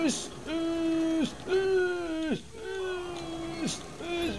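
shrill, frantic high-pitched yelps; panicked high squeals. Real, not acted. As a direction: squeal in sheer terror. Fleeing monster, panicked squeals.

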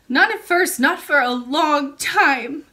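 put-on high, loud little girl, slowing at the end; child princess. A narrator's reading. Princess admits her trouble to the old lady, childlike.

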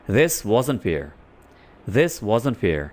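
"wasn't" run together at natural pace; fluent contraction. Shown as a demonstration. Teacher showing learners the fluent form, quick and natural. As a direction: say it smoothly, like a native speaker. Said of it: "अब was not को हम fluently बोलने के लिए".

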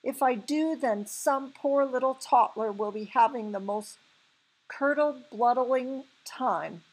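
crying out. A narrator's reading. BFG fearing for some poor toddler, crying out. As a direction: warn it loudly, pitch up. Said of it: "the B F G cried".

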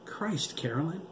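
high-pitched exclamation of alarm; alarmed cry. A narrator's reading. Doctor cries out to a colleague, high and alarmed. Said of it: "Doctor McAllister cried".

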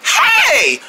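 high-pitched excited "hey"; excited call. Acted. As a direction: call out high to get friends' attention. Calling friends to a hiding place.

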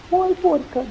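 put-on high startled little boy; scared child voice. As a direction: yelp like a frightened child. Child runs from the goat.

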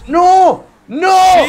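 two high, loud cries; high-pitched yell of protest; dismayed shout. Real, not acted. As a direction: wail no at the mishap. Fails in the game.

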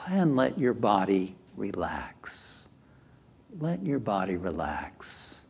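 measured, calming delivery that settles at phrase ends; guided meditation. Acted. Leads a guided relaxation.

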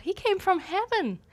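high, soft, smiling mother reply; mom's sweet answer. Retold or imitated. Mom smiles at her son, answering sweetly. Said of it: "smiled and said".

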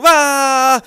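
loud startled yelp. Acted. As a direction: yelp as the door breaks. Door kicked in.

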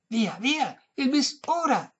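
high, drawn-out demand; insistent. A narrator's reading. Old lady demanding her encore, insistent high voice. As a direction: insist high, stretching the last words. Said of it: "insisté la vecchia".

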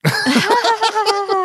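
loud burst of real laughter; big laugh. Real, not acted. Laughs at own joke. (lautes echtes Lachen)

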